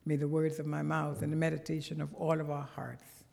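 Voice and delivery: ordinary talk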